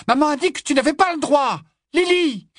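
put-on high, loud scolding girl's voice; bossy big sister. Big sister scolds her little sister, high and loud. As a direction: hands on hips, tell her off. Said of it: "les mains sur les hanches".

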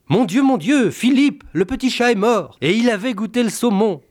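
high, loud panicked wail. Acted. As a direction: cry it out in horror to her husband. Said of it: "gémit".

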